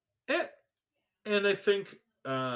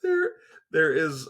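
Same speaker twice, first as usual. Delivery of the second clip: higher and louder, still laughing; recovering from laughter; 9 semitones higher, 10 dB louder than usual. Real, not acted. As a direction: get words out through a laugh. Trying to speak after laughing.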